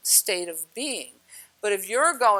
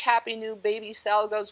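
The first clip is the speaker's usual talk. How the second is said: ordinary delivery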